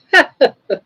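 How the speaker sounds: sudden high loud laugh; loud burst of laughter. Real, not acted.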